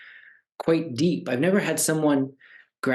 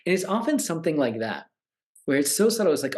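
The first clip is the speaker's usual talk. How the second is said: ordinary talk, nothing marked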